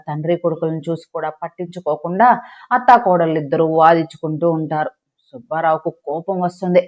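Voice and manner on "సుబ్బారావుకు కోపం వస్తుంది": ordinary delivery, nothing marked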